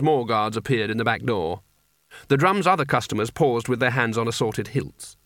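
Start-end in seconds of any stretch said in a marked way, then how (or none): none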